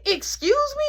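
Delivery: very high, offended question; indignant nana. Retold or imitated. Nana answers the uncle's slam, very high and offended. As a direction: take offense like a grandma. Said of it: "she said".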